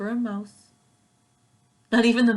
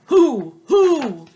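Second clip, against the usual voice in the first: loud higher hoo hoo; hollering owl; 7 semitones higher, 13 dB louder than usual. Acted. Outraged owl hollering at the sneeze, loud hoots. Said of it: "who hollered".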